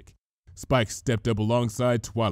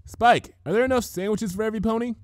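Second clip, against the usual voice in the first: calling out; 11 semitones higher, faster than usual. A narrator's reading. Twilight calling out to Spike.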